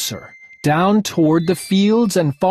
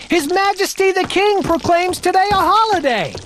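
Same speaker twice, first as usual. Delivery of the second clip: much higher, herald-like announcement; proclaiming; 13 semitones higher than usual. Acted. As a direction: announce it grandly.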